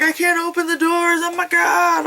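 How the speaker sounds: high-pitched panicked wail; mock freak-out. Guys trapped in the car on the tracks, mock panicked. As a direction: imitate trapped guys in hammed-up terror. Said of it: "the guys are freaking out".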